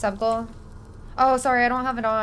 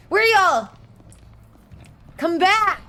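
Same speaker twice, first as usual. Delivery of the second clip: loud, higher calling out; 1 shouted chase call; 5 semitones higher, 3 dB louder, slower than usual. Pretend. Villain yelling after fleeing victims, loud calls. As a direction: yell after them as they run.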